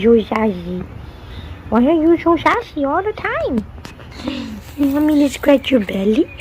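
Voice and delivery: high, cooing pet-talk voice, sing-song; baby talk to cat. Acted. Owner cooing to her cat Schmeely, high baby talk. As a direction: coo at the kitty like a baby.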